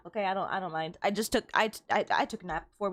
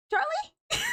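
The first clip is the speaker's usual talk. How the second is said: calling the dog. Retold or imitated.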